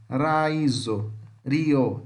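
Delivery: Raizo, rio opening on a single tongue-tap R; carefully isolated tapped R in Raizo, rio; tapped R demo. Shown as a demonstration. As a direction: flick the R at the start of Raizo and rio, no H sound. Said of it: "Esse é o som do R".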